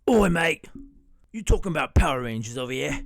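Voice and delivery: a whispered "Oi", then quick British chatter; British roommate voice. Acted. Roommate cutting into the video in a British accent. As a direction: barge in as a cheeky British mate. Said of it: "why he talks like that".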